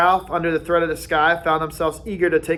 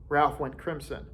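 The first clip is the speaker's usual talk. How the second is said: usual speaking voice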